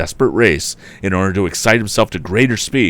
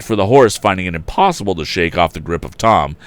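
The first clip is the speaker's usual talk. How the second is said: ordinary delivery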